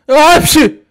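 high, loud sneeze. Acted. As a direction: burst out in a squeaky sneeze. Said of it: "hepsi hapşırıyor". (hapşırma)

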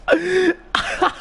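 a sudden squeaky high laugh; real squeaky laugh; high giggle. Real, not acted. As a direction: burst into a high-pitched giggle. Laughing at own rant.

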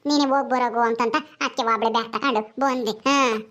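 lightly voiced visitor, pitched low; low, matter-of-fact village chat. Villager character explains arriving alone. (ಹಳ್ಳಿಯವರ ತಗ್ಗಿದ ದನಿಯ ಮಾತು)

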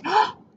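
a sharp gasp of wonder; surprised gasp. A narrator's reading. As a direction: gasp in sudden delight. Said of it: "but then he said".

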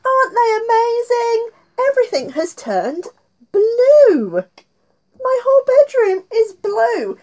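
high, bright amazement; excited. Acted. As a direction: gush over them to the kids. Gushing over the glasses.

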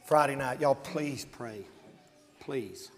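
low, soft, slow appeal; hushed plea. Real, not acted. Preacher asking congregation to please pray, hushed pleading. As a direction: beg quietly, voice dropped right down.

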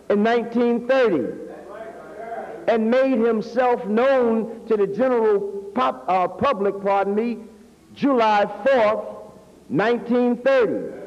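usual speaking voice

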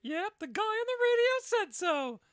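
very high hammed-up commercial voice; high cartoonish radio ad line; squeaky ad voice. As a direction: squeal it like a silly ad guy. Buddy voicing the ad.

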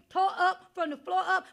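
normal talking voice

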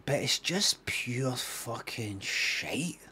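slow, soft, drawn-out verdict; hushed, deliberate dismissal; quiet disgust. Real, not acted. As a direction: say it low-volume, word by word. Repeats the deadpan verdict.

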